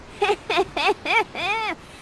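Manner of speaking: high hammed-up villain cackle; evil cackle. Shown as a demonstration. Prospera raising her staff, with a hammed-up evil cackle. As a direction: cackle like a witch. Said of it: "give us an evil cackle".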